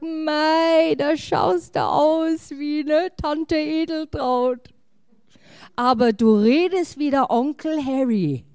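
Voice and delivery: gossiping relatives. Acted. Relatives comparing you to aunt and uncle, exaggerated exclaiming. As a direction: imitate relatives cooing comparisons, stretching the words.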